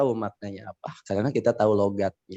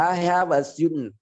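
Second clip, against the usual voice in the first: put-on slow, raised American-accented English; 5 semitones higher than usual. Teacher acting an American speaker for class, American accent. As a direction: say it slowly with an American dn ending. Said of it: "itu Amerika tuh".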